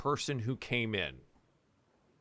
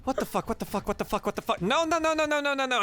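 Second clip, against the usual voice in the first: high, rapid panicked cursing; panicked swearing; 12 semitones higher, faster than usual. Acted. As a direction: spiral into panic in the cage. NPC panics seeing the cage.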